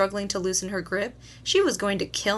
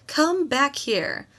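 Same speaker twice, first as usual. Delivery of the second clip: high, slow call after her; 6 semitones higher, much slower than usual. A narrator's reading. Mother calls fleeing daughter back, slow high call. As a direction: drag her back with your voice. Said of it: "my mother yelled".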